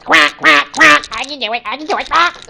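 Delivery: high, loud cartoon duck voice; quacking duck. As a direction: quack the words out eagerly. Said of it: "So duck says".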